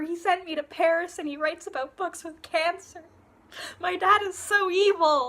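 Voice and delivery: high, hammed-up lament about a parent; melodramatic apology. Acting Anna's melodramatic apology and rant.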